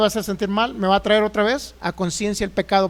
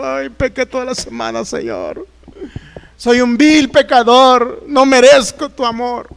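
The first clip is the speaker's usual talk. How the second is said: slow, whiny, tearful repentant sinner; hammed-up tearful confession, dragged out slowly; mock weeping plea; 2 semitones higher, 5 dB louder, slower than usual. Churchgoer at the altar begging God, mock weeping.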